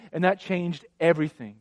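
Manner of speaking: ordinary delivery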